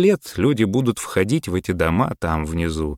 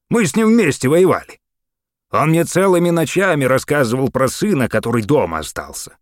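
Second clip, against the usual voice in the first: put-on raised, loud old soldier telling; a lively old veteran talking; old man's voice; 8 semitones higher, 5 dB louder than usual. A narrator's reading. Gramp telling the mayor about the war, raised and lively.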